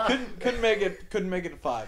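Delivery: high, loud laughing tease; laughing at the friend's confession. Real, not acted. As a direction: crack up while teasing.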